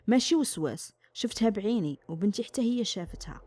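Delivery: ordinary delivery, nothing marked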